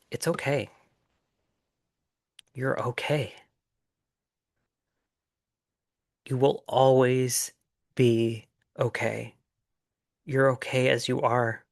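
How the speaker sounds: calm the listener like a kind inner voice; a hushed, peaceful it's okay. Retold or imitated. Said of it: "saying very peacefully".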